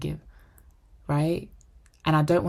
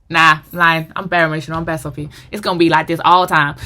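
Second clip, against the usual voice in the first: loud, playful confession; 12 dB louder than usual. Real, not acted. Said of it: "laughing admission of being emotional".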